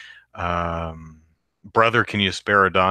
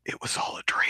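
fast whisper of a hated cliché; rushed disdainful whisper; 8 dB quieter, faster than usual. Real, not acted. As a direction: hush it out quickly. Mocking a hated trope.

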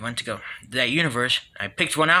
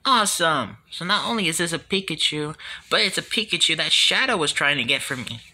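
usual speaking voice

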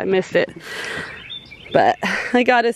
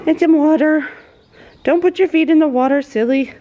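higher, sing-song voice for a puppy; playful puppy talk; 7 semitones higher, 4 dB louder than usual. Real, not acted. Scolding her puppy for feet in water, playful. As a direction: tease the puppy fondly, pitched up.

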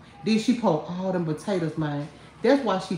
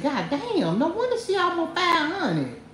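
mock amazement; 9 semitones higher than usual. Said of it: "I said".

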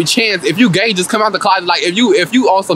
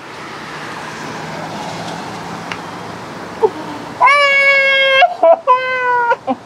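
shrieking laugh. Real, not acted. Cracks up at the reality-show line.

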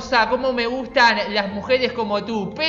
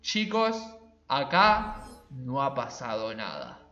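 deadpan murmur with long pauses; hushed cover-up; 10 semitones lower, 9 dB quieter than usual. Pretend. Gamer covering up a mishap to viewers, hushed and low.

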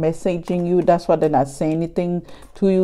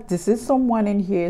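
ordinary delivery

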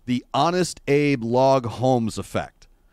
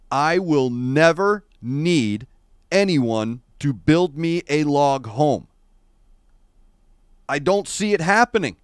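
usual speaking voice

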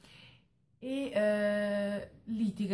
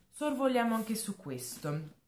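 normal talking voice

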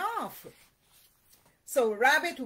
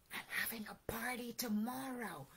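hushed, much softer storybook whisper; 13 dB quieter, faster than usual. A narrator's reading. Rabbit secretly telling Owl about tomorrow's party, whispered. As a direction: share it in a secretive hush. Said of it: "whispered Rabbit".

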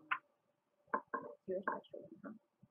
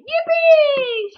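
much higher, loud yippee; joyful shout; 14 dB louder than usual. A narrator's reading. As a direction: yell it with pure glee. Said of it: "shouted Billy".

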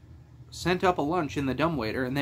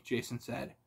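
ordinary delivery, nothing marked

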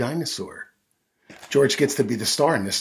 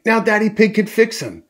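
high, louder mother's voice; raised, eager character voice; Mummy Pig's excitement; 11 semitones higher, 6 dB louder than usual. A narrator's reading. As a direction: announce the good news to the child. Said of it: "says Mummy Pig".

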